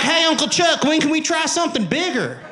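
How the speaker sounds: high, loud southern yell; southern accent. Acted. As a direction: holler like an excited southern boy. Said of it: "when did you get a southern accent?".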